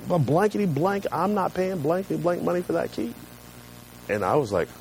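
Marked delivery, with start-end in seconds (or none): 0.1-3.1 high but softened retold rant; mock yelling. Upset customer refusing to pay for key, mock yelling. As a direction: imitate an angry customer without real volume. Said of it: "Just started yelling".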